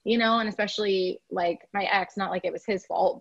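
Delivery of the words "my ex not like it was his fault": ordinary talk, nothing marked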